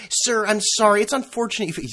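high, prissy support-agent voice; a lightly hammed-up polite agent; mock customer service rep. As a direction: ape the scripted phone rep. Then it goes into the script.

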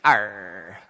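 low pirate arr; pirate growl. Acted. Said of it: "in honor of Talk Like a Pirate Day".

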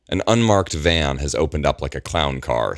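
plain reading voice

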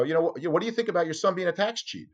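high, fast pushy reporter question; retold ambush question. Reporter to the mother on her porch, high and pushy. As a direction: fire the question at her, eager.

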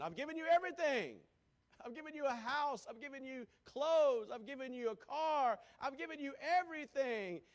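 husband shouting his case in a marriage spat; angry husband. Retold or imitated. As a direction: hammer each gift at her like a grievance. Husband argues with wife in office.

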